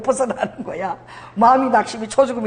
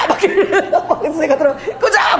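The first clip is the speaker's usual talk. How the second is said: high laughing yell of 끄자; laughing mock shout; 7 semitones higher than usual. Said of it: "머리를 막 흔들고".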